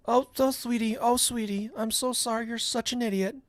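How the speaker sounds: high, syrupy put-on sympathy; mock pity. Host imitating the caller's fake apology, mock pity.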